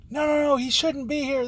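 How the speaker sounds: high-pitched mock frantic objection; imitated agitated protest. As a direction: mimic her worked-up protest, pitched high. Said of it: "she's yelling and getting crazy".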